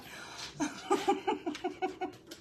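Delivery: high airless giggling fit; a real wheezy giggle; breathless giggle. Real, not acted. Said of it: "I've been laughing at him".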